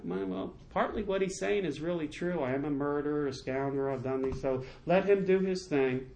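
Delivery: softly voiced, subdued concession; resigned admission. David answers men who want the heckler killed, quiet resigned admission. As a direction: own up quietly and let it go.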